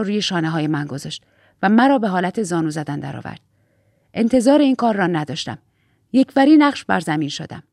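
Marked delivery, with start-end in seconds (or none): none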